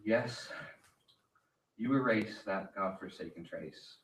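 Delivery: ordinary talk, nothing marked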